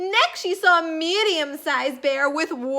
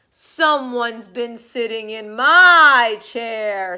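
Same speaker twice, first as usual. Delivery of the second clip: Papa Bear; 3 semitones lower, 7 dB louder than usual. A narrator's reading. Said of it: "said Papa Bear".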